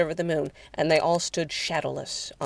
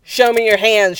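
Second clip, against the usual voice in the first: loud, raised command; shouted order; 5 semitones higher, 8 dB louder than usual. A narrator's reading. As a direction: shout it at a suspect. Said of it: "shouted Cat-, Cody".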